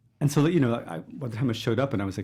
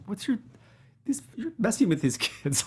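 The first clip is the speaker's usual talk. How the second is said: incredulous retort; 9 semitones higher than usual. Retold or imitated. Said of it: "I just wanna be".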